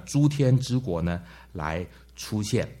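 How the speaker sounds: normal talking voice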